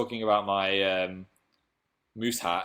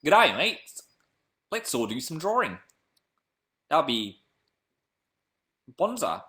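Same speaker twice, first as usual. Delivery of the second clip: hammed-up Australian with swooping pitch; cheesy, badly faked Australian accent; terrible Australian accent; 4 semitones higher than usual. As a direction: do a bad Aussie impersonation. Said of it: "I've got terrible accents today".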